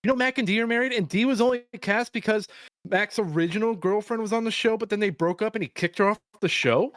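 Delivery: high eager know-it-all voice; mock trivia fan. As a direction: mimic an annoying fun-fact guy. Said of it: "fun fact that everyone just pulls out".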